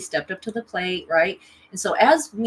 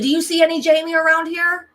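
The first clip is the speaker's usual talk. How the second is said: high, loud mock demand; acting out an indignant comeback; retold indignant challenge; 10 semitones higher, 6 dB louder than usual. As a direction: throw the question out high and loud. Retold quarrel with ex.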